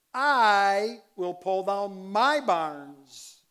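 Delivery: very loud, slow, high hammering on "I" and "my"; stress on I, my. Shown as a demonstration. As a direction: belt out every "I" and "my", drawn out. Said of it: "I'll accent it".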